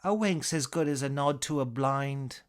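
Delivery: cheeky English comic patter; British accent. Acted. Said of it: "your British accent".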